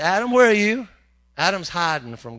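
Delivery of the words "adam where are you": high, loud call; booming call for Adam; calling God. Pretend. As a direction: call out through the garden. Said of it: "He said".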